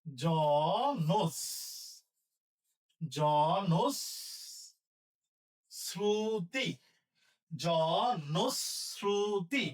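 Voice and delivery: জনশ্রুতি split and drawn out as "jonos-ruti"; syllable by syllable. Shown as a demonstration. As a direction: stretch জনশ্রুতি slowly for dictation. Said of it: "এই উচ্চারণ তাহলে দন্তসা".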